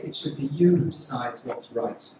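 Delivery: ordinary talk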